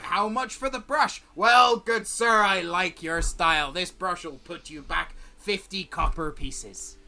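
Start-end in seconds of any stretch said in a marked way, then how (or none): 1.3-7.0 lightly voiced scruffy salesman; a fawning trader reeling off his wares; stall merchant. GM as merchant selling tools to the party, lightly voiced.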